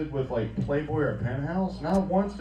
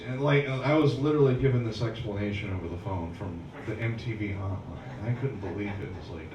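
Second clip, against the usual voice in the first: usual speaking voice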